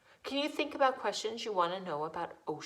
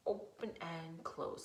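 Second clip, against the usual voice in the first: a hushed, much softer whisper; 5 semitones lower, 11 dB quieter than usual. Shown as a demonstration. Teacher whispering the claw instruction to kids. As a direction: whisper the words like a secret.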